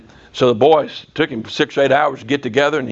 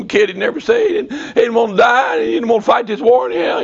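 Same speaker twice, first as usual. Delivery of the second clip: high-pitched mock bawling; imitated crying; 7 semitones higher than usual. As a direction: wail it like a retold sobbing young soldier. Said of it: "began to cry and bawl".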